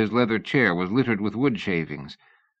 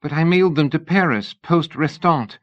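a worried lady pressing for answers, pitched up; young lady Sybil, anxious; 8 semitones higher, 4 dB louder than usual. A narrator's reading. Narrator voices Sybil.

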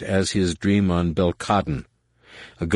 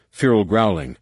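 plain reading voice

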